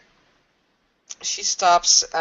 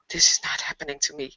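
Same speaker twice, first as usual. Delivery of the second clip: fast, hushed whisper; breath-only frightened muttering; whispered denial; faster than usual. Acted. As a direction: whisper it quickly to yourself in disbelief. Scared character muttering denial.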